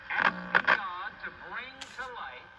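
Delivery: normal talking voice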